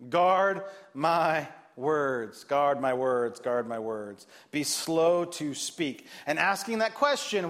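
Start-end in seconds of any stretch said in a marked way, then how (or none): 0.1-4.2 very slow, falling lower each time; slow fading repetition. Acted. Preacher repeating the point, slow and fading. 4.5-6.1 slow, deliberate words; slow delivery. Acted. Preacher urging the congregation, slow deliberate. Sermon point.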